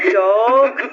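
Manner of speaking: laughing it off as a joke; laugh, then tease; teasing laugh. Acted. Friend teasing about the boyfriend, laughing it off.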